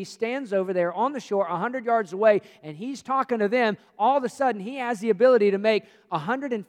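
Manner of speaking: loud, building sermon proclamation; preaching at full force. Acted. Preaching builds on God's power.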